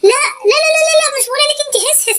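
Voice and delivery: very fast, high stream of no's; hurried denial. Acted. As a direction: rush to deny it.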